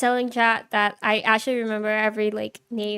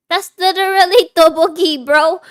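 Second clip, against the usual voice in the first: loud exclamation; 6 semitones higher, 12 dB louder than usual. Real, not acted. Shouting at the screen.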